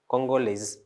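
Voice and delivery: CONGOLESE as kon-go-lez; feminine pronunciation. Shown as a demonstration. Teacher explaining the added E, sounded ending. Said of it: "we pronounce the S as".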